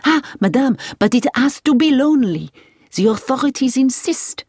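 enthusiastic French driver. A narrator's reading. Driver answers Hilary about the lonely place, emphatic French driver. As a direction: explain emphatically as a keen local driver.

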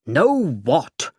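cross. A narrator's reading. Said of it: "I said crossly".

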